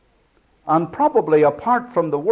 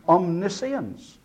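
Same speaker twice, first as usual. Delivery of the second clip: normal talking voice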